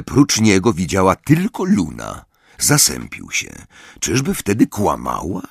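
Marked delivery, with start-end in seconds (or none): none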